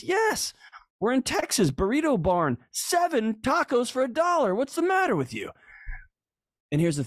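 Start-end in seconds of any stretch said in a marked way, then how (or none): 0.0-5.5 mock wife voice.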